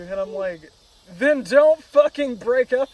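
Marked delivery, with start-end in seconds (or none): 1.2-2.9 loud, high exasperated retort; retold exasperation. Replaying his reply to the girlfriend, loud exasperation. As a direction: throw it back at them, fed up.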